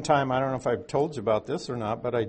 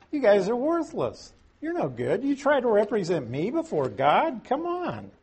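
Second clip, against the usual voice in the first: higher, exasperated brush-off; Job's scornful rebuke; 7 semitones higher than usual. Retold or imitated. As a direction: scold them as worthless. Job scolding his useless advisors.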